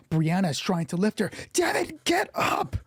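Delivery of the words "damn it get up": very high, strained plea; desperate cry. Acted. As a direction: cry out frantically to rouse a friend. Brianna desperately tries to lift Sam.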